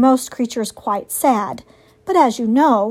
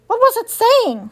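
much higher squeaky groundhog; small anxious critter, high; 11 semitones higher, 4 dB louder than usual. A narrator's reading. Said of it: "said Digdown the groundhog".

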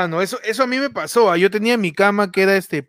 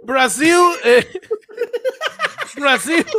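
laughing; 8 semitones higher, much slower than usual. Real, not acted. Host joins the chant laughing.

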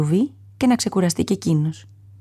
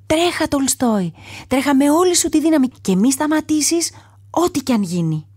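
urgent shout; 7 semitones higher than usual. A narrator's reading. Mouse urges lion to flee.

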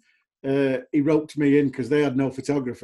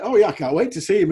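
retold excitement; 5 semitones higher, 6 dB louder than usual. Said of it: "I was like".

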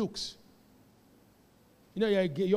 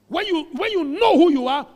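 loud, much higher declaration; preaching shout; 8 semitones higher, 11 dB louder than usual. Real, not acted. Preacher telling the congregation who they are, loud. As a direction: proclaim it at full force.

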